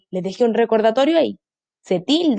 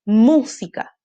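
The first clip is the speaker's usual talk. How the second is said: "Música" with MÚ held and louder; stress on first syllable; 5 dB louder, much slower than usual. Shown as a demonstration. Teacher shows children the tonic syllable, "Música" stressed on MÚ. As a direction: hit MÚ hard in "Música". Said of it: "Aquí está su sílaba tónica".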